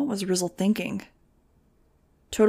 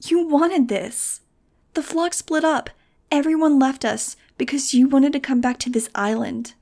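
high, slow, disbelieving accusation; incredulous girl; 6 semitones higher, 4 dB louder than usual. A narrator's reading. Nudge accusing Max of wanting to return, incredulous. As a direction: throw it back at her in disbelief. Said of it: "was incredulous".

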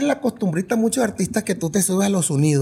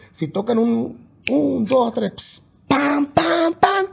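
mock band blasts; 8 semitones higher, much slower than usual. Musician imitating brass section hitting in unison. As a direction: punch each pam like horns together.

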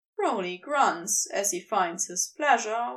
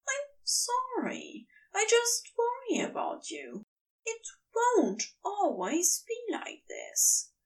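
reassuring Aziraphale; 9 semitones higher than usual. Acted. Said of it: "says it to reassure himself".

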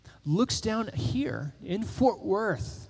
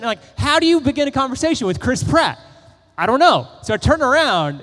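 louder, higher, flustered retelling; flustered excitement; 7 semitones higher, 7 dB louder than usual. Retold or imitated. Telling the congregation about the celebrity, flustered and loud. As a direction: relive the starstruck panic.

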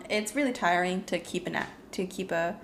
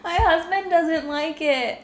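slow, higher, laughing through the words; 6 semitones higher, 3 dB louder, slower than usual. Real, not acted. Host finishing the title after a slip, laughing. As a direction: read it while losing it to laughter.

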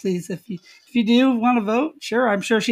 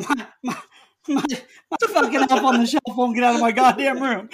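Sammy the Elf; 2 semitones higher than usual. Acted. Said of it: "Looks like we have Sammy the Elf joining us".

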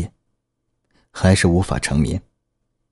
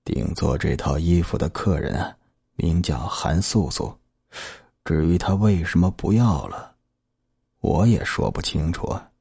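put-on slow old man; 2 semitones lower, 5 dB quieter than usual. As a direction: drag the words like a weary old man. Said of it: "才慢腾腾地说".

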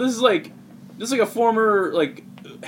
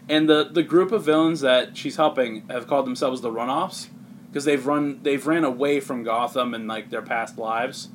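normal talking voice

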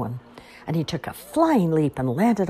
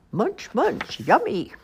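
high munching critter; lightly voiced spider; 7 semitones higher, slower than usual.

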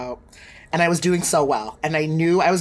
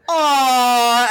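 loud, high drawn-out "aww"; higher, louder imitation of guests going "aww"; sympathetic aww; 6 semitones higher, 5 dB louder than usual. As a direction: coo like a touched crowd, exaggerated. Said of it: "everyone's like".

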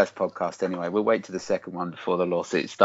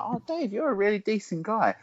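mock sweet voice; 4 dB quieter than usual. Mock quote of people.

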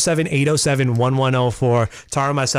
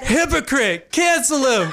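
mock outrage; 12 semitones higher, slower than usual. Jokingly voicing listener.

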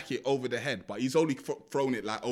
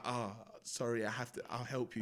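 low, hushed killer's apology; retold soft apology; 4 semitones lower, 10 dB quieter than usual. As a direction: lower and soften it, imitating reluctant regret. Killer apologises to injured victim.

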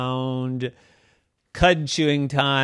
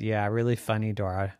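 sarcastic friends; 6 semitones lower, 9 dB quieter than usual. Acted. Friends mocking Dora's joke, dry and sarcastic. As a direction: roll your eyes at her.

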